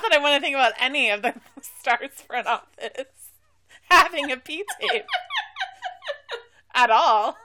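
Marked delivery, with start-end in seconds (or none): 3.9-7.4 talking through giggles; laughing. Real, not acted. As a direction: get it out while giggling. Laughing through awkward joke.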